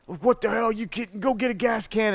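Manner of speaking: mock an irate grandpa yelling; cussing grandpa. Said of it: "he's cussing us out".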